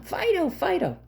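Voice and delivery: high pleading call of the dog's name; mock yelling a name. Owner at the table calling the dog off. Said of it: "you're yelling".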